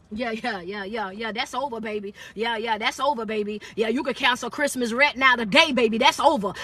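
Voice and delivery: a soft low chuckle of a follow-up; quiet aside. Real, not acted. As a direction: savour it half to yourself. Soft afterthought after a climax.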